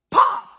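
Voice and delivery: high, loud burst of pop. Acted. Turtle bursting like a balloon, loud pop.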